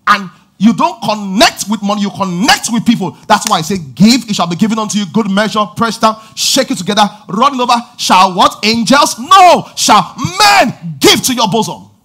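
preaching at full power to the church; full-force preaching. Acted. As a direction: shout the scripture over the congregation.

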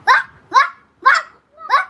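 put-on loud, high yappy barks in a child's voice; dog sound imitation. Boy does the dog's sound for the live viewers, loud mock barking. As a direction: bark each word out like a little dog. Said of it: "പട്ടിയുടെ sound എടുക്കും".

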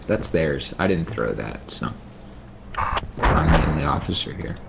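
ordinary talk, nothing marked

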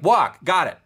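a dog perking up at its favorite word; eager dog. Retold or imitated. The teacher voices the dog jumping at the word walk, high and loud. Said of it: "the dog goes like".

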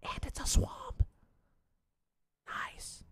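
slow whisper to self; whispered aside. Real, not acted. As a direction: breathe it out under your breath. Muttering at card reveal.

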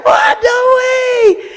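very high, loud cry of disbelief; shocked shout. Retold or imitated. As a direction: yell it like a miracle just happened. Their reaction to approval news.

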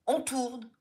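a film director's shouted call to roll. A narrator's reading. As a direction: announce it to the whole crew. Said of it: "hurle à nouveau la voix".

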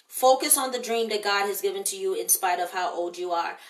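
ordinary talk, nothing marked

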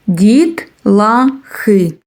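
very slow: Діт-ла-хи; teacher stretching the word for children; syllable by syllable. Shown as a demonstration. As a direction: sound out "Дітлахи" piece by piece. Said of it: "syllable counting demo".

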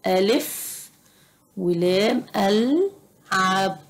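ألعب stretched slowly in two parts; drawn-out word. Shown as a demonstration. Teacher building a word from its letters, slowly sounded out.

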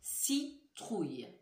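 Citrouille said slowly, syllables clear; slow careful word. Shown as a demonstration. Teacher slowly says citrouille for listening. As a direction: draw out Citrouille. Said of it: "Réfléchissez bien".

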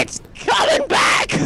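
high urgent yell; alarm shout. Real, not acted. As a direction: shout the danger out. Storm returning, warning.

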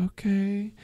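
high mock-girl agreement; high-pitched, imitated acceptance; eager girl. Acted girl agreeing to get in.

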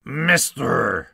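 bossy Lorax. Acted. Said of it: "he spoke with a voice that was sharpish and bossy".

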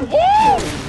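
high, sudden "woo"; excited whoop. Real, not acted. Excited reaction during a game fight.

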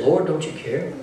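soft, low, inward version of the plea; quiet hurt question. Retold or imitated. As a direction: say it softly, as the real hurt underneath. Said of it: "what they were really saying when they said this".

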